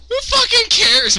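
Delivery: who fucking cares, shouted up high; indignant shout. Real, not acted. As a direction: yell it out in mock outrage. Outburst at viewer count.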